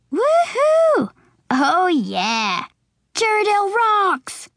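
high whooping crowd cheer; wild cheering. A narrator's reading. Audience cheering for their town, high and whooping. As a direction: whoop it up like a fan.

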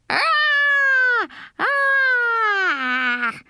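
high drawn-out screech. A narrator's reading. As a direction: screech out a long, high scare cry. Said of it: "I screeched".

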